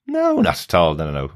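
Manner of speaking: usual speaking voice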